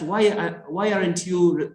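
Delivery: ordinary delivery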